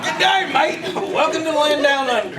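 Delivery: loud, high welcoming call; hearty greeting. Acted. Australian guide welcoming a late surfer, hearty and loud. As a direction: hail the newcomer heartily. Host hails the newcomer.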